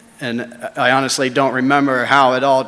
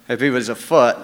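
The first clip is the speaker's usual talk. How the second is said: usual speaking voice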